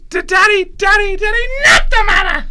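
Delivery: put-on high shouting baby voice. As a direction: squeal it like a happy baby. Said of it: "be the baby".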